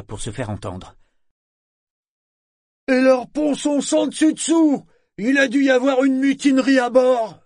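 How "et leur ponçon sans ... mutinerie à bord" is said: shouting over wind. Acted. Sorkor shouting over the storm.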